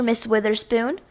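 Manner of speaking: usual speaking voice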